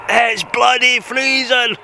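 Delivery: drawn-out high exclamation; exclaiming about the cold. Real, not acted. Vlogger out in freezing cold.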